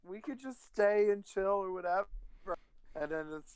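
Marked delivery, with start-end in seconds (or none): 0.0-2.1 high, breezy woman's voice; a man doing a woman's voice, pitched up; girl voice. Retold or imitated.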